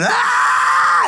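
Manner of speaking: very high, shrill imitated baby scream "Ah!"; baby's scream. Pastor imitating the screaming kid in the video, high shriek. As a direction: shriek like an unhappy toddler. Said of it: "he was screaming".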